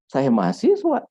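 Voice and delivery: "Saya mahasiswa" asked loud and very high on "mahasiswa"; question intonation. Shown as a demonstration. Lecturer contrasting intonations for students, question intonation. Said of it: "intonasinya berbeda".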